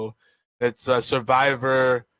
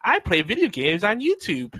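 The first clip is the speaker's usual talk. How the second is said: put-on entitled hipster girl; 8 semitones higher than usual. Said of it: "one of the girls is like".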